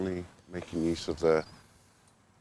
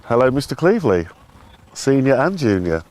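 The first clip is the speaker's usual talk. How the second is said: loud, higher cheerful hello; calling out; 6 semitones higher, 11 dB louder than usual. Real, not acted. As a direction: call across the field. Greeting arrivals across the ground.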